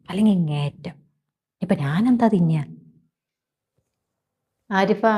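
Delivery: ordinary talk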